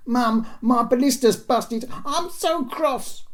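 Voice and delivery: put-on high, loud whining boy's voice; petulant young boy. A narrator's reading. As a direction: whine it loudly like a cross little boy. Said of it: "the voice of a petulant nine-year-old boy".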